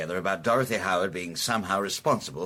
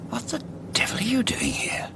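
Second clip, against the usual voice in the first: hushed whisper. Acted. Father surprised, hushed at daughter.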